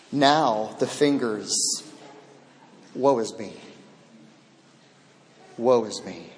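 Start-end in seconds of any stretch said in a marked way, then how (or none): 2.9-6.3 quiet lament. Retold or imitated. Isaiah's lament voiced.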